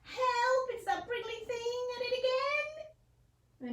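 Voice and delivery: high frantic moose voice; panicky moose. Acted. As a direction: yelp in alarm at the prickly thing.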